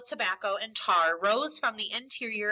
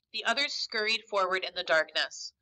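ordinary delivery, nothing marked

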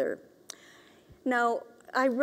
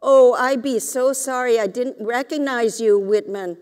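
louder gushing apology; apologetic auntie; 2 semitones higher, 7 dB louder than usual. A narrator's reading. Auntie Sadie apologizing to Whitman, louder. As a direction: gush sorry at him. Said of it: "said Auntie Sadie".

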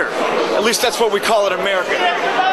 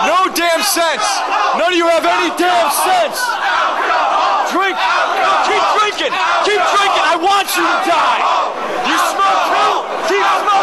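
taunting shout; 6 semitones higher than usual. Acted. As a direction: yell the taunts up high at the fans. Heel taunting the drinking crowd.

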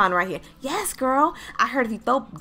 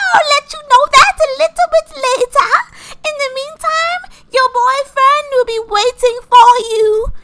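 odd fairy godmother; 12 semitones higher, 9 dB louder than usual. Acted. Godmother promises the girl a boyfriend, very high and loud. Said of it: "why are you talking like this".

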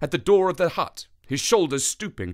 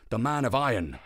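plain reading voice

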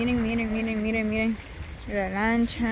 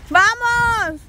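cheering; 7 semitones higher, 3 dB louder than usual. Real, not acted. Cheering on arriving friends.

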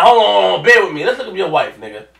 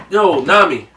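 ordinary talk

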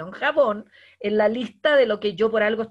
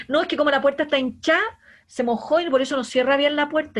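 excuse-making staff; 6 semitones higher, 7 dB louder than usual. Acted. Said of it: "¿Y qué me importa".

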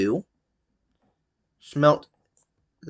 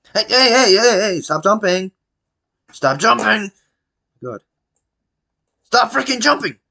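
frustrated yelling; 8 semitones higher than usual. Real, not acted. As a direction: yell at him to stop jumping. Yelling at jumping character.

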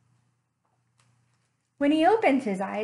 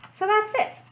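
triumphant; 10 semitones higher, 3 dB louder than usual. Acted. Cat narrator's triumphant wrap-up.

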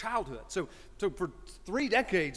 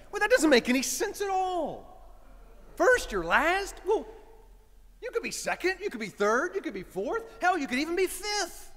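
high, loud, scoffing father voice; dismissive dad; 10 semitones higher, 4 dB louder than usual. Retold or imitated. Dad scoffing at his son's lifelong motto, high voice. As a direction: brush off his own old advice.

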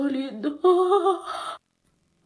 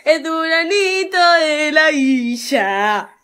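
mock announcer; 5 semitones higher, 10 dB louder, much slower than usual. Mock announcing posing friend.

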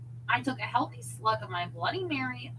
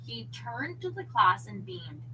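ordinary delivery

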